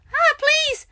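high plea. Real, not acted. Begging the game.

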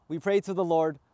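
ordinary talk, nothing marked

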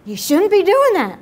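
tut-tut, half laughing at it; mock disapproving onlooker. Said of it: "everybody will look at me and be like".

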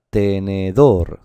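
tenedor slow, loud on final DOR; sounded out. Shown as a demonstration. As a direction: stretch tenedor up to a strong DOR. Teacher sounds out the word.

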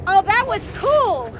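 ordinary delivery

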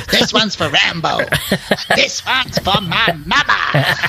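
mock heroic battle cry, drawn out; hammed-up action hero. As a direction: play the movie avenger for laughs. Hammed-up imitation of film's revenge line.

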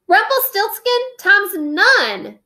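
put-on a boy hurling a magic command; shouted spell. A narrator's reading. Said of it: "he shouted".

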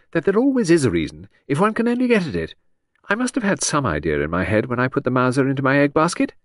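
high, breezy, rambling society lady; vague aristocratic lady. A narrator's reading. Lady rambling cheerfully to the inspector, high and airy. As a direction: chatter on brightly as a scatterbrained aristocrat. Voices Lady Angkatell at length.

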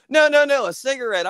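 high, loud burst of denial; hasty retold correction. English gamer correcting the American, high mock-urgent protest. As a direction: rush in to clear up a misunderstanding.

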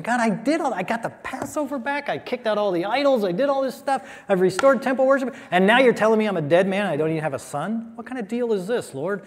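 high-pitched aggrieved protest; indignant Hezekiah. Acted. Hezekiah complaining to God he must die, high and aggrieved. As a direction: list what you did, outraged and pleading.

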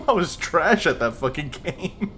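slow, laughing self-mockery. Real, not acted. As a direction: laugh through the words. Laughing at himself.

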